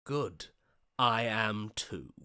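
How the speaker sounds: usual speaking voice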